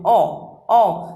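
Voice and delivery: औ as an open aw, drawn out; औ said like English "aw" in hall; औ sound. Shown as a demonstration. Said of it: "तो इसे औ sound करते हैं". (औ को खुला "ऑ" बोलें)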